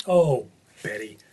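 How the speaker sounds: put-on slow, drawn-out "Oh, Betty" like a fond old grandmother; grandmother's sigh. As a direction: say "Oh, Betty" slowly, with a long sigh on "Oh". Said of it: "what my grandmother would always say".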